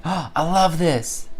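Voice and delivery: slow, high gushing delight. Retold or imitated. At dinner, gushing over a beloved fish dish. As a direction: gush over a favourite dish. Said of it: "I'm like".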